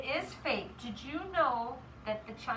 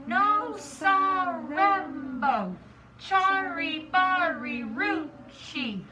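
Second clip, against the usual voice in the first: slow, loud syllable-by-syllable chant; deliberate, emphatic, drawn-out recital; very slowly; 5 dB louder, much slower than usual. Acted. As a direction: spell out each piece of the long name for all to join. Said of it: "very slowly said his name".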